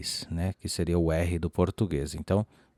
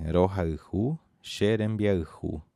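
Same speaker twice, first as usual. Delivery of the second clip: "Rohaihu xerembiaihu" read slowly; slow model; slower than usual. Shown as a demonstration. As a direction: present the new phrase slowly. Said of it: "terceira frase que diz assim".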